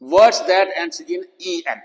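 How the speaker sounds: slow, deliberate reading of a rule heading. Shown as a demonstration. Teacher announcing a spelling rule slowly.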